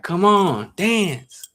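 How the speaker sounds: high mock chant to dance; mock urging. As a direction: imitate the girls nagging him onto the floor. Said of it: "They're like".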